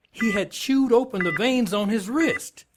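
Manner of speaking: pitched high, insistent; high-pitched, exasperated lieutenant; incredulous report. Acted. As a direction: report it in pointed disbelief. Lieutenant stressing a grisly report.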